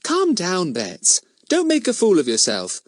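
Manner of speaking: put-on exasperated older brother; brotherly scorn. A narrator's reading. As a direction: scold your little sister. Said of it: "in his nice brotherly way".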